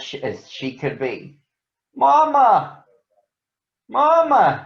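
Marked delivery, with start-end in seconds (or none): 1.9-4.6 put-on high, loud child's cry; shouting for Mama. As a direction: call out for Mama, upset. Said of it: "she shouted".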